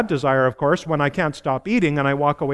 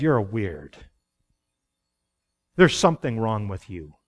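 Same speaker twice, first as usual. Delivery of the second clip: lower, dismissive sneer; scornful world; 6 semitones lower than usual. Retold or imitated. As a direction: look down on them as you say it. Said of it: "the world looks at that and goes".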